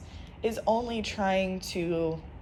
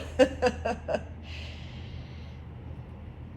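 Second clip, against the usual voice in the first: shaky laugh; 5 semitones higher, 5 dB quieter than usual. Real, not acted. Emotional laugh after near tears.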